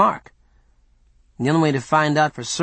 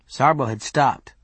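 ordinary delivery, nothing marked

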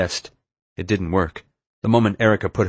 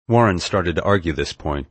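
ordinary delivery

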